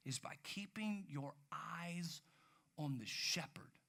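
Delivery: slow hushed whisper. Acted. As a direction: pull them in with a slow whisper. Preacher drops voice for key line.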